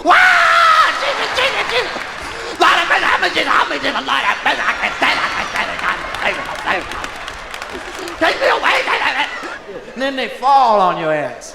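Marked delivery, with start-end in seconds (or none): 0.0-9.6 hammed-up wail, high bursts falling into breathless repeats; mock funeral wailing. Mourner letting it hang out at a funeral, mock wailing. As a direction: wail it out like an over-the-top mourner.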